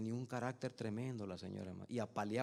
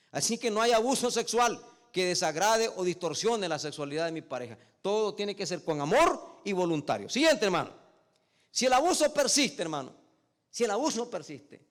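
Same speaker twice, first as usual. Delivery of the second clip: loud, high pulpit preaching; fiery preaching; 7 semitones higher, 12 dB louder than usual. Acted. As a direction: hammer the point home to the congregation. Pastor preaches at full force. (predicación enérgica y fuerte)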